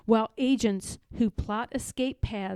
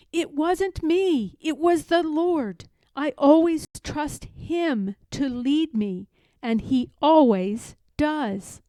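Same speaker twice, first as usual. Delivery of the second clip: higher, louder earnest testimony; Harriet humble; 4 semitones higher, 5 dB louder than usual. Acted. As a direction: give the glory to the Lord. Harriet gives God glory.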